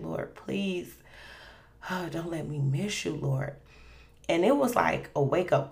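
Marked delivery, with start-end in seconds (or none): 0.0-3.6 softly pleading, with a sigh; pleading prayer. Retold or imitated. Praying in bed to the Lord, soft plea. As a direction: sigh and gently beg, retelling a prayer. Retold prayer after waking hurt.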